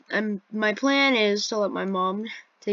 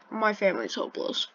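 normal talking voice

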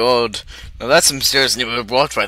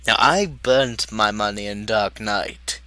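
normal talking voice

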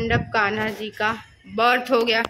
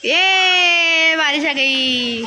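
long drawn-out, raised "ye" of delight; excited cheer; 3 semitones higher, 4 dB louder, much slower than usual. Real, not acted. Vlogger greeting the sudden rain with an excited cheer. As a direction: cheer it out slowly, stretching the first word.

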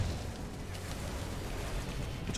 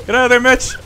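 higher, louder warning call; urgent shout; 10 semitones higher, 8 dB louder than usual. Real, not acted. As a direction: yell the warning to a teammate. Warning teammate in game.

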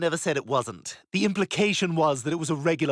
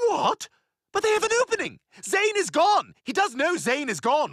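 high, squealing disbelief; shocked outrage; 12 semitones higher, 3 dB louder than usual. Real, not acted. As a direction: yelp it as if betrayed. Outraged surprise at the insult.

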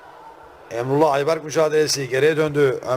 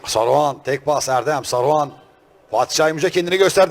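usual speaking voice

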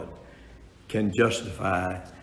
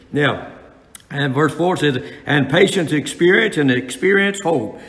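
preaching at full force; 4 semitones higher, 5 dB louder than usual. Acted. Preaching on patience.

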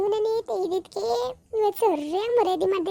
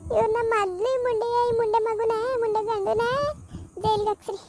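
put-on remorseful old aunt. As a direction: wail out your regret, then curse her. Same old aunt figure. (ಪಶ್ಚಾತ್ತಾಪದ ಅಳುವ ಚಿಕ್ಕಮ್ಮ)

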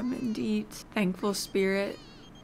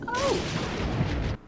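startled gasp. Real, not acted. Gnome reacts badly.

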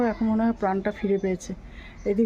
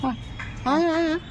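higher sing-song coaxing; coaxing the dog; 4 semitones higher, faster than usual. Real, not acted. Urging the hungry stray to eat, higher coaxing. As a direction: lure it to the biscuits with a coaxing voice. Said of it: "আমি খুব আস্তে ভয়, মানে, কথা বলছি".

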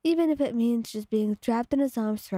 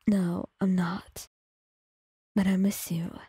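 gentle; 3 semitones lower than usual. A narrator's reading. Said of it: "he says gently".

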